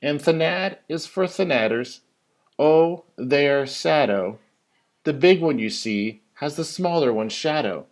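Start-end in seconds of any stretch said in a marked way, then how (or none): none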